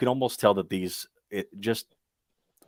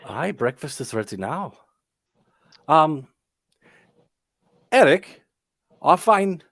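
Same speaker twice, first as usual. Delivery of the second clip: put-on Sorvia's Irish accent, clearly higher; 7 semitones higher, 3 dB louder than usual. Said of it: "the accent, yes, [name]".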